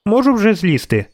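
put-on high-pitched child's voice; little girl. A narrator's reading. Girl up a tree asks the witcher, high child's voice. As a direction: ask as a small girl, pitched well up.

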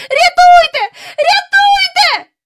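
very high, loud shriek for rescue; piercing cry for help; screaming for help. Acted. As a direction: scream it out in full panic. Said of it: "Вона кричала".

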